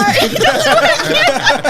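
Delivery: loud, high burst of laughter; real belly laugh, high and loud. Real, not acted. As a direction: crack up loudly. Big laugh at story.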